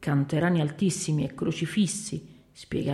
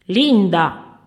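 loud shout of a name; scolding shout; 10 dB louder than usual. A narrator's reading. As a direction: call the worker sharply, very loud. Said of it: "gridò la padrona". (grido forte di richiamo)

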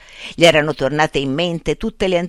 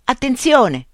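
higher sharp cry; shouted warning; 8 semitones higher, 3 dB louder, slower than usual. A narrator's reading. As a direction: cry out as a finger hits his eye. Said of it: "gridò Nunez". (grido d'avvertimento, più acuto)

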